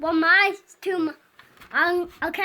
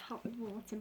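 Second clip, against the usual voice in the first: whisper; 5 semitones lower, 17 dB quieter, much faster than usual. Real, not acted. Child whispers aside.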